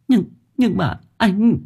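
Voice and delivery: high, slow, hesitant objection; small, high frightened voice; timid protest. A narrator's reading. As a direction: falter out a pleading protest.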